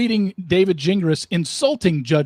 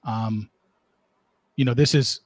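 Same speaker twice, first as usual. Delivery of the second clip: ordinary talk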